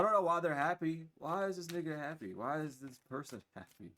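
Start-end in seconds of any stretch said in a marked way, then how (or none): none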